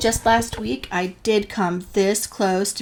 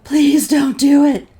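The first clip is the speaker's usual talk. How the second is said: wheezy breathless plea, slow; wheezing; 6 semitones higher, 8 dB louder, slower than usual. Retold or imitated. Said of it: "I wheeze".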